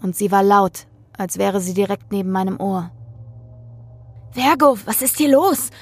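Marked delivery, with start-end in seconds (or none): 4.3-5.7 a sharp, higher hiss of alarm. Acted. Cameraman hisses at the host mid-broadcast, higher and alarmed. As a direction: hiss it urgently from behind the camera. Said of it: "zischt Dave hinter der Kamera".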